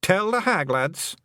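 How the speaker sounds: put-on high, lilting Scots-accented little man; a cheeky tiny clansman owning up; wee Scottish Feegle. Feegle chief confessing to the young witch, high Scots voice.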